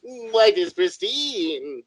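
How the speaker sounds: slow, high, soft alien voice. Pretend. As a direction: drawl it out like a dreamy alien. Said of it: "This is my alien voice".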